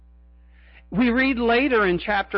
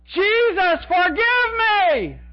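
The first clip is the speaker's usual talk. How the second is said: much higher, louder, slow pleading shout; desperate cry; 12 semitones higher, 4 dB louder, much slower than usual. Pretend. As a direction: cry out from the back of the crowd. Judas imagined yelling from crowd.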